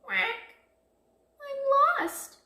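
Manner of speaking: very high little duckling voice; small sad voice. A narrator's reading. Lost duckling alone in the woods, small sad voice. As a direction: squeak it like a tiny lost duckling. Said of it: "he heard a small, sad voice".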